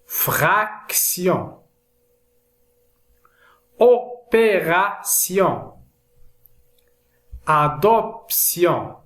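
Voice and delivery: slow, syllable-by-syllable French word list; measured dictation with pauses between words; careful word modelling. Shown as a demonstration. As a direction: sound out each word for learners. Teacher reads example list for learners.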